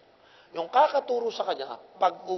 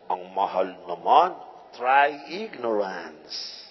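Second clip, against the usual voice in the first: slow, low mocking voice; slow mock whine of a stingy member; mock complainer then retort; 8 semitones lower, much slower than usual. Preacher mimicking complainers, then retort.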